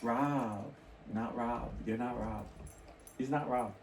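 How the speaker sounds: low, quiet, halting self-correction; groping for a name. Retold or imitated. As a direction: think aloud softly, pausing between tries.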